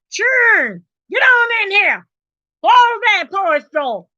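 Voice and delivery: high-pitched country yell; country holler. Acted. Country woman calling children in from the porch, country holler. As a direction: holler country-style across the yard. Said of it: "They know you got country in here".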